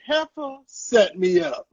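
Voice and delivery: slow, lightly voiced comic quote; Pharaoh impression. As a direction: drawl it out like the comedian. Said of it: "like this comedian Pharaoh said, he said".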